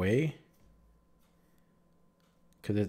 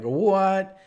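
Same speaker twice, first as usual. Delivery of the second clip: louder, high drawn-out what of disbelief; stunned what; 9 semitones higher, 7 dB louder than usual. Retold or imitated. Replaying the moment for viewers, a loud incredulous what. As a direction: let the disbelief spill out long. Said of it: "but I'm like".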